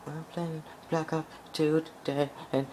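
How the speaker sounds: a hint of high whining; lightly hammed-up sulk; mock mopey gamer. Dumped gamer rambling to viewers, light mock whine. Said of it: "be like".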